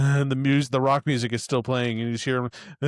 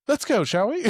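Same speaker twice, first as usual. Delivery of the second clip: higher, genteel zombie Philip suggesting they leave; lightly voiced prim gentleman, pitched up; polite Philip; 10 semitones higher than usual. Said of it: "he's like".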